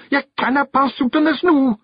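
very high Scottish protest, mild scream; Rob Anybody screaming. A narrator's reading. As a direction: urge her frantically in a little Scot's voice. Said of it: "Rob screamed".

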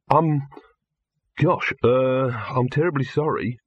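halting, embarrassed apology; flustered babble. A narrator's reading. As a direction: stumble apologetically over the words. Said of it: "babbled Victor".

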